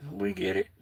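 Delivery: murmured acceptance; quiet echo. Real, not acted. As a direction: let it fall away to yourself. Softer echo of own phrase.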